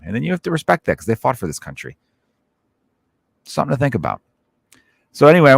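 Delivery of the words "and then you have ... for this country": ordinary talk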